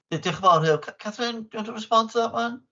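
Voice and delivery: normal talking voice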